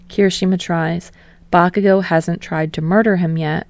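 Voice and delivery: plain reading voice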